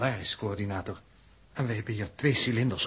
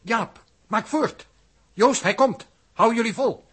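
urgent call; 8 semitones higher, 5 dB louder, slower than usual. Acted. Urgent shouting to hurry.

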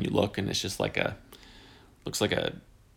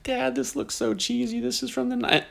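imitating the kids; 4 dB quieter than usual. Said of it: "And they were like".

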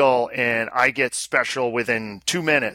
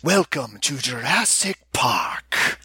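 movie quote voice; much slower than usual. Pretend. Quoting the movie line.

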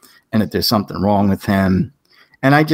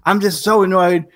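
higher pitched, exasperated mock scolding; fed-up rant; 9 semitones higher than usual. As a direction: vent irritation at a coworker, half-acted. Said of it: "I said".

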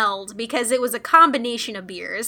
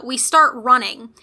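ordinary talk

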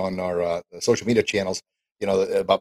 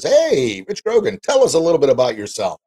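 loud, higher, hammed-up host intro; mock show host; 5 semitones higher, 6 dB louder than usual. Podcast host mocking a show host who asks him to introduce himself, loud and hammed-up. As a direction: ham up a peppy host putting a guest on the spot. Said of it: "somebody says".

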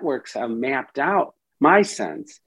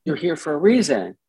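higher, inviting and curious; welcoming therapist; 6 semitones higher than usual. Shown as a demonstration. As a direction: invite the part in gently. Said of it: "We say".

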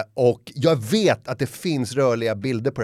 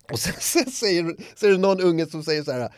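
normal talking voice

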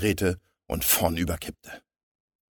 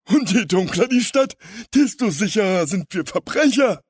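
high, loud, tittering crook voice; giggly villain; 9 dB louder than usual. A narrator's reading. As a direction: gloat with a giggle in the voice. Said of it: "kicherte der Große". (kichernde Schurkenstimme)

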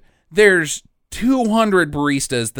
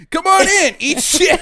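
high, loud mock welcome; hammed-up greeting to customers; brash club hostess; 11 semitones higher, 4 dB louder than usual. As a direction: belt it out like a cheery hostess. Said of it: "Her first line is".

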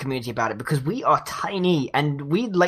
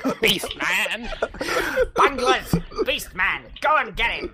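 high cackling cartoon villain; Skeletor; 4 semitones higher than usual. Commanding henchmen as a villain, hammy high voice. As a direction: ham it up, stretched out and laughing. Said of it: "now Skeletor's joined us".

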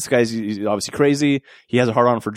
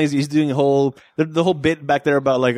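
ordinary talk, nothing marked

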